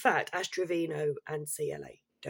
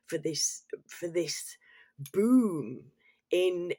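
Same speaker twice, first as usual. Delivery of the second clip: whisper; much slower than usual. Real, not acted. Aside dropped to a whisper.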